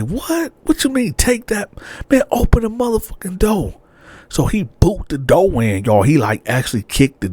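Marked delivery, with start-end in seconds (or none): 0.0-3.8 high, incredulous, furious; can't believe she said that; high outraged yell. Retold or imitated. Boyfriend shouts through the door at girlfriend, high and outraged.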